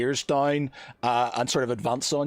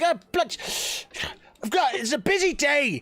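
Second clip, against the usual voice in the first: much higher, harried, blustering captain; irate captain; 10 semitones higher than usual. Acted. Captain scolding the lizard boy on a busy day, irate. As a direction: fume at the stowaways like a busy captain. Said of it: "It's like".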